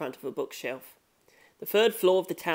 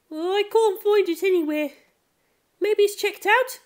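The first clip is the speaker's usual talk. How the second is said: put-on much higher, light girlish voice; girl's voice; 4 dB louder than usual. Futaba, can't find the book in the library, higher girl voice. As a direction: lift into a young girl searching shelves.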